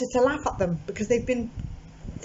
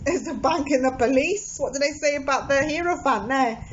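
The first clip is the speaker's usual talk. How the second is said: laughing; 3 semitones higher than usual. Real, not acted. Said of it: "That's why I'm laughing".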